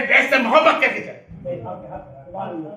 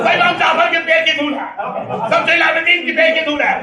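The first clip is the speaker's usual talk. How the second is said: raised sermon cry, slowing at the end; full-force preaching; 4 semitones higher than usual. Acted. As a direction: proclaim it to the congregation. (پورے جوش سے خطابت)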